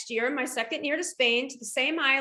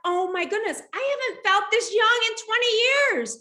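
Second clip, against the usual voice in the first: put-on excited older women; 8 semitones higher, 4 dB louder than usual. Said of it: "They're like".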